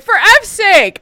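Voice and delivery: high, loud exasperated outcry; exasperated outburst. Retold or imitated. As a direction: blow up in frustration. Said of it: "I'm like".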